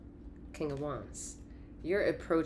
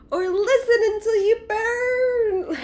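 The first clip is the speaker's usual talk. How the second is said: high, loud, slow commanding cry; fierce shout; 11 dB louder, slower than usual. Acted. The cards insisting on Queen of Wands, high shout. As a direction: roar it like a fiery queen.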